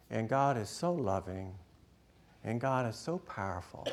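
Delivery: hushed bedtime wondering, sinking to a whisper; sleepy child's question. Retold or imitated. Six-year-old asking Dad at bedtime, softly puzzled. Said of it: "he was going to sleep, six or seven years old".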